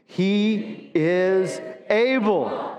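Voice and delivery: each word stretched, ending on able; very slow emphatic. Shown as a demonstration. Pastor calling everyone to say it, very slow. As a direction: drag it out so all can join. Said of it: "I want everybody to say it".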